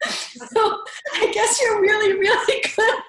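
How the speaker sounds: real laughter through tears, high and loud; tearful laughing. Real, not acted. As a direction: burst out laughing while tearing up. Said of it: "now I'm crying again".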